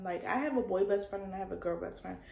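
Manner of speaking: ordinary talk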